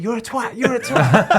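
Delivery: high, laughing mock snap; mock insult. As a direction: hurl the insult while half laughing. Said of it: "he snapped".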